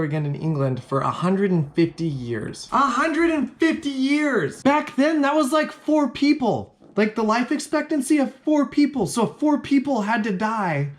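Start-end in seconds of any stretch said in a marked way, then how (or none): 2.7-4.6 high, slow, stunned repeat; hammed-up astonishment; incredulous exclamation. Real, not acted. 4.6-10.9 high-pitched comic disbelief; exasperated, high-voiced explaining; incredulous rant. Real, not acted.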